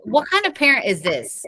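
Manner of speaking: ordinary delivery, nothing marked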